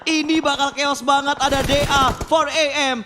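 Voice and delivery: loud, high hype shout; excited call. Real, not acted. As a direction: sell the chaos. Caster calling a big fight.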